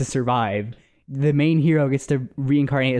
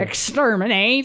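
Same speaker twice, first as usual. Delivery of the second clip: higher, slow, drawn-out Dalek screech; 8 semitones higher, slower than usual. Retold or imitated. Mocking the Daleks' catchphrase, slow robotic screech. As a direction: grind out each syllable like a robot. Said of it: "them dumbass commercials".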